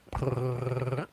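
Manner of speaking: cat-calling purr. Shown as a demonstration. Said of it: "That's how I call kitties".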